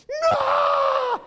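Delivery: very high, loud screamed no; screaming toddler. Retold or imitated. Two-year-old pitching a fit in a restaurant, high scream. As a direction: pitch a full-blown fit in one screech. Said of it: "he is literally screaming".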